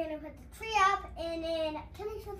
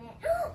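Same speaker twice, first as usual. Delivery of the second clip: excited gasp; 10 semitones higher than usual. Real, not acted. Child remembers decorating the tree.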